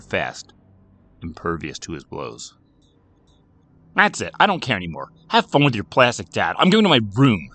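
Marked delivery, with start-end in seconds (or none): 3.9-7.5 loud, fed-up outburst; storming off angry. A narrator's reading. Boy quitting the argument with sister, storming off angry. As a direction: throw it over your shoulder, done with this. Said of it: "said Jason as he stormed off".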